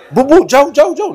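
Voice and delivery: high, urgent shooing; shooing away. Retold or imitated. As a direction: shoo them off in mock alarm.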